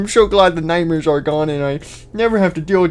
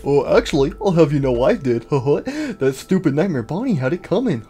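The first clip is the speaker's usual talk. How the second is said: normal talking voice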